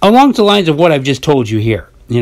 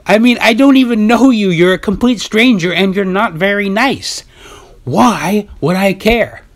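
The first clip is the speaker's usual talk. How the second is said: high retorting voice; 7 semitones higher than usual. Retold or imitated. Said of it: "I respond with my voice".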